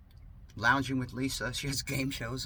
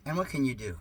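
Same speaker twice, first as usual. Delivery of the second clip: normal talking voice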